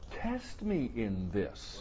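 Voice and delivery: slow, soft invitation; God's gentle challenge. Acted. God invites the people to test him, slow calm invitation. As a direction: offer it slowly and calmly.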